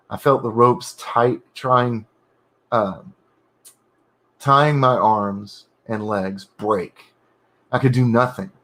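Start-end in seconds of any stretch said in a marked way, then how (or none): none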